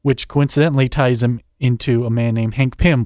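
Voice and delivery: ordinary talk, nothing marked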